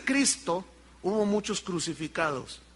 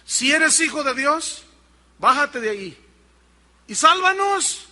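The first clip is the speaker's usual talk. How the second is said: raised, higher-pitched mock yell; imitated taunting yell; 5 semitones higher, 4 dB louder than usual. Crucified criminal yelling at Jesus to save them, imitated shout. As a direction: call it up like the jeering criminal. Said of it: "Uno le gritaba".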